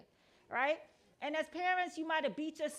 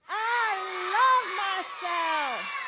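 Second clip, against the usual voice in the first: loud, high shouted chant; outside voice; 6 semitones higher, 10 dB louder, much slower than usual. Real, not acted. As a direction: shout it out with pride. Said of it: "I want you to use the outside voice".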